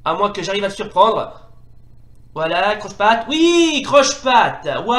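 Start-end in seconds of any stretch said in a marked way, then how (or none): 2.8-5.0 high, loud gleeful yes; elated gamer shout; triumphant cheer. Real, not acted. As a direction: cheer the trip as it lands. Trip move lands.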